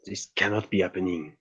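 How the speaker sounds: ordinary talk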